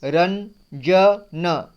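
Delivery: Ranjan drawn out very slowly, syllable by syllable; teacher sounding out Ranjan at a crawl; slow syllable reading. Shown as a demonstration. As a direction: spell out Ranjan slowly for the class. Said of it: "र में अंग की मात्रा है".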